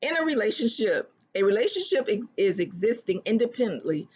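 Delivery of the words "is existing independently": normal talking voice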